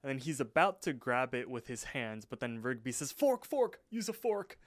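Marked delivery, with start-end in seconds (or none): 3.1-4.6 alarm him before he grabs it; urgent hiss. Retold or imitated. Urgent warning at dinner table.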